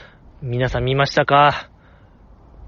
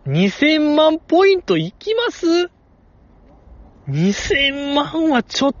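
stunned disbelief; 10 semitones higher, slower than usual. Real, not acted. Disbelief at the huge score.

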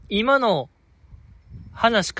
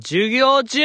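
a mock yell, higher; loud retort; 6 semitones higher, 3 dB louder, much slower than usual. Boy shouting back at a pestering classmate, mock yell. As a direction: cut him off with a sharp loud call. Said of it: "とりあえず大きい声でなんか返す".

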